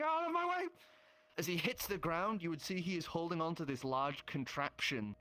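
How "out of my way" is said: high, loud yell from above; shouting. Acted. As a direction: scream it while falling. DM voices man falling screaming.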